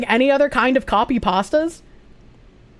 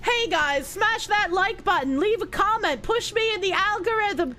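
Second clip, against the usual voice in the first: soft mock youtuber outro; 8 semitones higher, 7 dB quieter than usual. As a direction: parody a youtuber plea.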